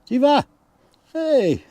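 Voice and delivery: loud, high call across the yard. Real, not acted. Owner calls out to Siva mid-tour, loud high call. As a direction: shout for Siva to get her attention.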